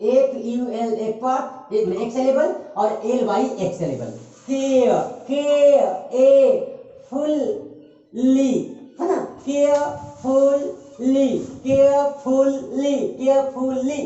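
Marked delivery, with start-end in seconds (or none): 4.5-8.5 care held long, then fully split as फुल...ली; syllable by syllable. Shown as a demonstration. Teacher shows how to break it, care and fully very slowly. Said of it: "कैसे तोड़ेंगे?". 9.4-14.0 carefully chanted as केयर-फुल-ली; three separate syllables. Shown as a demonstration. Teacher shows three syllables, carefully broken apart. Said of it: "ये तीन syllable में टूट रहा है".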